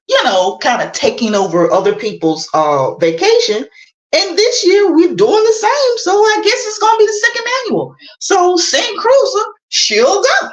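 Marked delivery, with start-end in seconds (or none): 4.1-10.5 excited high pitch. Real, not acted. Hyping the fundraiser.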